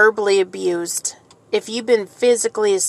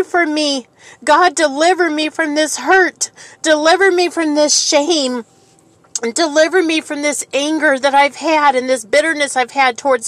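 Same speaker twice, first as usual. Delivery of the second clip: crying out to God; 5 semitones higher, 4 dB louder than usual. Shown as a demonstration. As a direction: plead with God for deliverance, then gradually settle. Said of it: "cry out to God and say".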